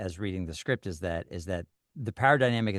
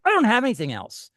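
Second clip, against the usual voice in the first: much higher and louder, pleading; desperate plea; 9 dB louder than usual. Retold or imitated. Bank customer insisting to the scammer, a high, loud desperate plea. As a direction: cry out that there's nothing left to give. Victim trying to take power back.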